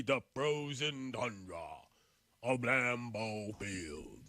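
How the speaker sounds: slow, low, grand NFL film narration; dramatic announcer voice. Pretend. Mock-grand NFL sports talk.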